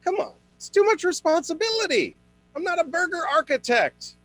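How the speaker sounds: high whiny complaint; exasperated whine. Acted. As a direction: whine it, high and put-upon. Exasperated rant about menus.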